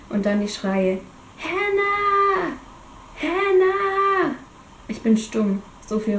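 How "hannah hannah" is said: calling out. Acted. Kane calling out after crash.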